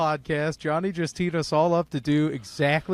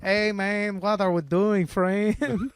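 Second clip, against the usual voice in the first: American soldier impression; 6 semitones higher, slower than usual. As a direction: put on a joking immigrant GI voice. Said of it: "your average American soldier sounds like".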